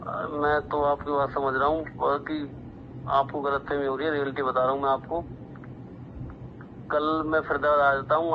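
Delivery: normal talking voice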